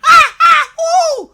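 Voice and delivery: shouted high monkey calls, very loud; frantic chimp shrieks. Pretend. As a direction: yell like a howling monkey. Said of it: "and sing".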